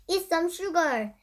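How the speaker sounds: ordinary delivery, nothing marked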